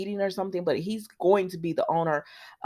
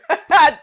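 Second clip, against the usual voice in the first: loud, high burst of laughter; real amused cackle; 9 semitones higher, 13 dB louder than usual. Real, not acted. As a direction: crack up loudly. Cracks up at Deacon's reaction.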